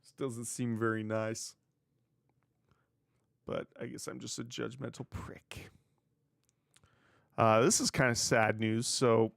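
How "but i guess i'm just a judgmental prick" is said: hushed, near-whispered admission; self-deprecating aside. Real, not acted. Host admitting he judged someone's wife, self-deprecating aside. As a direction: drop it right down under your breath.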